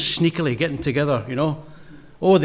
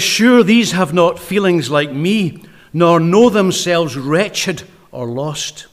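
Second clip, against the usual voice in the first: slow reading; 5 dB louder, much slower than usual. Shown as a demonstration. Preacher reading poem lines.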